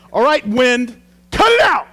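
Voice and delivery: hammed-up yell at the wind, climbing very high; comic high-pitched shouted command; mock yelling. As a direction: scold the weather like a naughty dog, at a mock full shout. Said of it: "I've never yelled at a storm".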